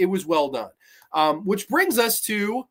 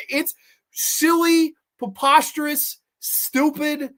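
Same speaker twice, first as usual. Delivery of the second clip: high drawn-out verdict; 9 semitones higher, slower than usual. Real, not acted. Exaggerated listing of verdicts.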